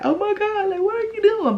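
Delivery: high, squeaky pretend-tearful gush; mock happy crying. Imagined happy girlfriend reacting to a proposal, mock tearful. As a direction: imitate being overcome, pitch way up. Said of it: "I would have been fucking crying like".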